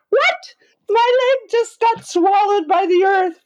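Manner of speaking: squeaky high startled cry; mock shock. Hiker sinking in the mud, high startled disbelief. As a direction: leg sucked into mud, voice leaps up. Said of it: "It's like".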